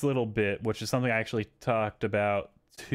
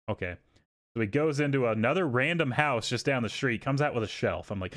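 ordinary talk

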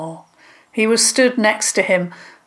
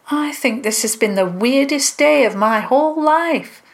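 lightly voiced girl, higher pitch; a hint of a girl marvelling; higher, chatty character voice; girl in a story; 6 semitones higher than usual. A narrator's reading.

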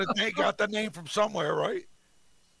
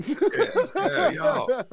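laughing; 9 semitones higher, 5 dB louder, much slower than usual. Real, not acted. Laughing at a joke.

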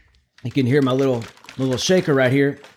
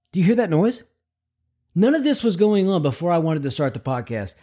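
usual speaking voice

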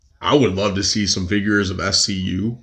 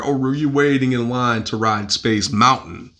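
ordinary delivery

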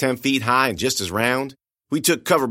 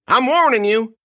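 put-on high, loud menacing old man; Otis threatening; 12 semitones higher, 6 dB louder than usual. Old man pumping shotgun at captive, loud high threat. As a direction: threaten him, pitched up and loud.

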